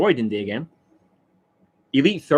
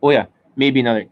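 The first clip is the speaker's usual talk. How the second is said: ordinary talk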